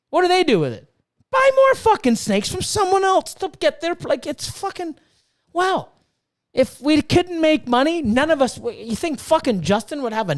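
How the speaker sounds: heated rant. Real, not acted. Breeder ranting at people who shame making money, high and heated. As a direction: let the frustration push the pitch up.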